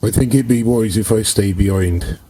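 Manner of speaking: normal talking voice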